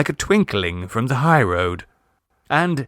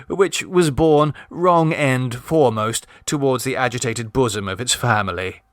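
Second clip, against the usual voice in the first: ordinary delivery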